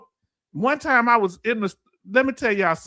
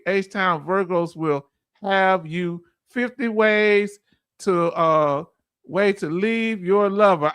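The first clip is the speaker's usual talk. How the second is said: very slow, drawn-out delivery; slow Southern talk; slower than usual. Real, not acted. Reading a viewer's comment to the stream, very slow. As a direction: stretch every word out slowly. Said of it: "I talk slow".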